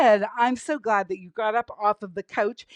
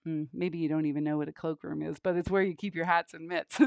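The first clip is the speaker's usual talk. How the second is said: ordinary talk, nothing marked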